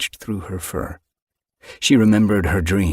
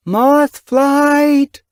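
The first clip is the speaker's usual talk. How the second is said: loud, high call across the moor; calling cry; 8 dB louder than usual. Acted. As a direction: call her daughter from far off. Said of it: "Windrunner's cry".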